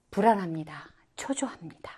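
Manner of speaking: hushed, worried whisper; anxious whisper. Shown as a demonstration. Teacher voicing a worried mother, in a hushed whisper.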